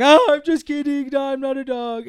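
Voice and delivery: high, loud put-on goofy voice; goofy joking boyfriend. Boyfriend reassures parents after barking, goofy joking. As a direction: play it high and silly, easing off.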